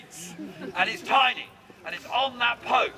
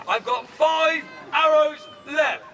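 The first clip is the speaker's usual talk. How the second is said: slow, raised, emphatic announcement; dramatic announcement; 4 semitones higher, slower than usual. Shown as a demonstration. Archer tells the crowd the arrow count, slow and dramatic. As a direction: announce it slowly with weight on each word.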